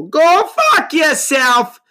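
high, loud, slow yell that cracks; voice-cracking yell. Real, not acted. Podcaster telling critics off, voice cracking yell. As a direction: bellow it till the voice breaks. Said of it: "My voice cracked".

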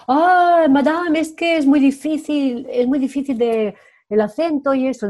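whiny student. Retold or imitated. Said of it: "cuando me dicen".

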